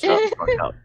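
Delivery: loud. Real, not acted. Player yelling at his teasing friend, loud. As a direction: yell it at your teasing friend.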